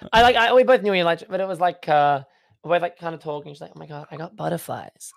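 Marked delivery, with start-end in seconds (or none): 3.8-5.1 imitated American accent, softly; softer mock-American voice. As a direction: quote her in an American accent. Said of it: "I put an American accent on".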